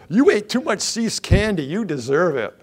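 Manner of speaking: mock-angry dentist blaming a patient for candy; hard legalistic dentist. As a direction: berate the patient like a harsh dentist. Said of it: "hard, legalistic dentist might say".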